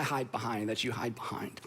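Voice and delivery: a confiding whisper to the crowd. Real, not acted. Preacher whispering to the congregation about hidden excuses. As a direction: drop to a whisper as if sharing a secret.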